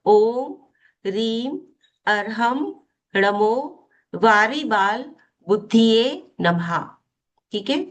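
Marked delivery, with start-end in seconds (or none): 0.1-6.8 word-by-word mantra, pausing after each; deliberate, measured chant with spaced-out words; slow recitation. Shown as a demonstration. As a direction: recite slowly so learners can follow. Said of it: "Riddhi मैं धीरे धीरे बोलूंगी".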